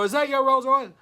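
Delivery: a shopper stunned by the Rolls Royce, excited; excited question. Retold or imitated. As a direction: ask it like you can't believe your eyes. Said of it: "and they go".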